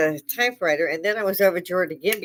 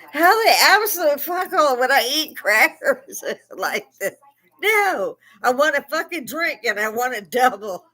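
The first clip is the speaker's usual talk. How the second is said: exasperated; 7 semitones higher, 4 dB louder than usual. Retold or imitated. Cramped passenger snaps at stewardess.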